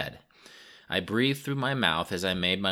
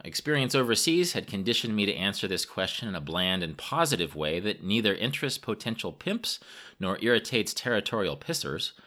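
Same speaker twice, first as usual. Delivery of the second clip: ordinary delivery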